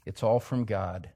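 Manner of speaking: usual speaking voice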